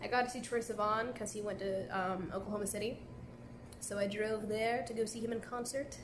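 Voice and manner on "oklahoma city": normal talking voice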